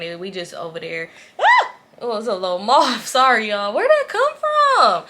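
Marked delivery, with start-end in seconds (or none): 1.4-1.7 short, high, loud shriek; real jump-scare squeal; startled yelp. Real, not acted. Startled by a moth. 3.7-5.0 high, stretched-out squeal; real startled question after a fright; squealed in surprise. Real, not acted. Startled by moth mid-story.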